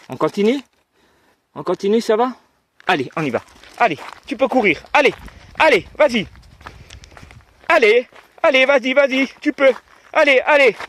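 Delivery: very high, loud cheering; talking to a dog. Real, not acted.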